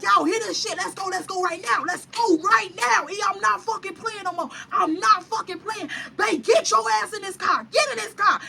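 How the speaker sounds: fed-up guard ordering them into the car; getting mad. Retold or imitated. Security guard losing patience with the fighting girls, angry shout. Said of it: "So now he getting mad".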